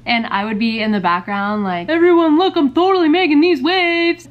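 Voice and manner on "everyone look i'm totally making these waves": high, loud, excited shout; excited kid showing off. Retold or imitated. Kid calling everyone to watch her make waves, excited shout. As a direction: call out like a show-off kid in the pool.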